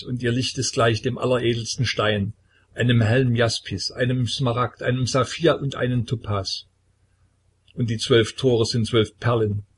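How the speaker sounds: ordinary delivery, nothing marked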